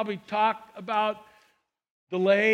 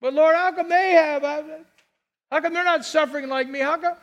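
higher, louder whine of envy; envious complaint; 6 semitones higher, 4 dB louder than usual. Retold or imitated. Preacher mimics a believer envying others before God, whiny.

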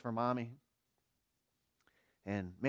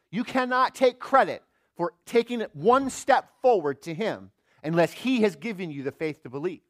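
higher and louder fervent preaching; preaching hard; 7 semitones higher, 12 dB louder than usual. Acted. Preacher pressing the congregation, higher and louder. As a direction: drive the point home from the pulpit.

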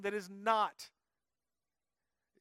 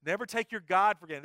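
ordinary delivery

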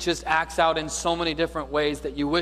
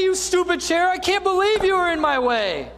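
higher and louder, ranting at the chair; mock angry outburst; 6 dB louder than usual. Preacher acting out a man yelling at a chair, hammed-up anger. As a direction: act out a man cursing furniture. Men acting out stubbed-toe rage.

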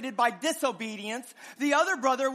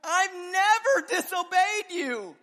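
mock-indignant son; 6 semitones higher, 3 dB louder, slower than usual. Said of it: "He actually says at one point".

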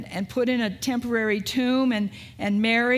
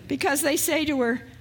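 ordinary delivery

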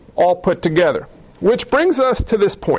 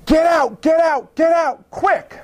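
fireman shouting; 8 semitones higher, slower than usual. Retold or imitated. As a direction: yell the warning like a fireman in a fire. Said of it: "a fireman comes tearing into the room".

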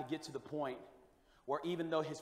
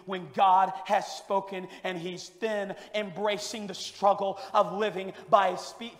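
usual speaking voice

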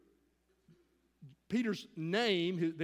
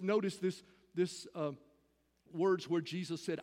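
ordinary delivery

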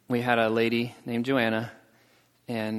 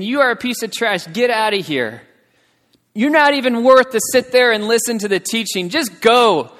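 loud, raised-pitch mock scolding; ragging on someone; 7 semitones higher, 8 dB louder than usual. Villagers running down an outcast woman, loud scolding. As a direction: berate her loudly, as the villagers did. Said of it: "started ragging on her".

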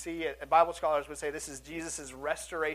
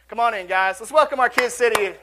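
loud welcome to the room; calling out; 3 semitones higher, 13 dB louder than usual. Real, not acted.

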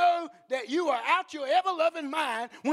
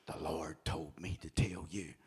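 low, whispered holy message; mock-spooky prophetic whisper; creepy self-styled prophet; 11 dB quieter than usual. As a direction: whisper it as if passing on a divine secret. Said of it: "scares the bejeebies out of me".

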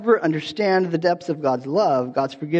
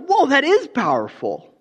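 amazed; 8 semitones higher than usual. Retold or imitated. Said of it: "And then I was like".

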